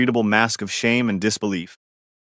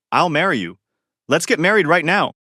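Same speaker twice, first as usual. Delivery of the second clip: forced desperate smile; 6 semitones higher than usual. A narrator's reading. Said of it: "forcing a smile".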